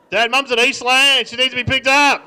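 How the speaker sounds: put-on loud, high kid yelling to dad; child shouting the news; calling out. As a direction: holler a message across the house. Kid relays message to Dad.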